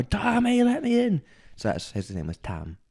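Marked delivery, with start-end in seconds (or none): 0.0-1.2 high, loud call through the door. Retold or imitated. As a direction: bang and shout to be let in. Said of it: "He'd bang on the door".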